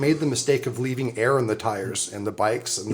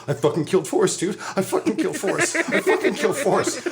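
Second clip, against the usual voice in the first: panicked; 8 semitones higher than usual. Retold or imitated. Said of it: "just going".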